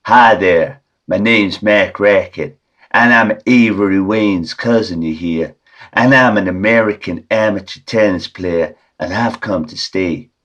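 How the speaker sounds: a hint of an American tennis player introducing himself; American accent. Tricking his wife at the door, light American accent. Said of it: "an American voice like this".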